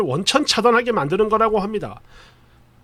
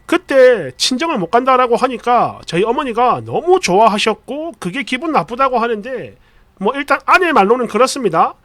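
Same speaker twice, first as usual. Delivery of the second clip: plain reading voice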